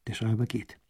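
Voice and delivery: plain reading voice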